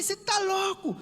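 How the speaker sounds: very high-pitched angry outburst; beside himself. Retold or imitated. Retelling his outburst at the driver, high and beside himself. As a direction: fling it out high, as if out of control. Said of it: "tava transtornado".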